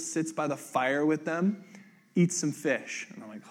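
ordinary talk, nothing marked

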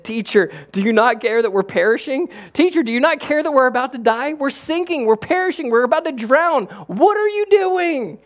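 high, loud, frantic pleading; terrified, shaking him awake in a storm; panicked disciples. Acted. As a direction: disciples screaming at sleeping Jesus in a sinking boat. Panicked disciples wake Jesus.

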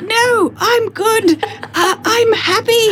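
very high, whiny pleading voice; not-dead man. Retold or imitated. Not-dead man pleading against the cart, high whine. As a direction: plead to stay, squeaking way up high. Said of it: "And he's like".

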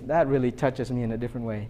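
ordinary delivery

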